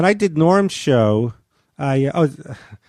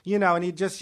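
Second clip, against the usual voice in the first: ordinary talk, nothing marked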